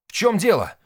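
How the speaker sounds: high, loud demand; explosive outburst. A narrator's reading. Nik left out of the talk, exploding. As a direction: explode with impatience. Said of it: "взорвался Ник".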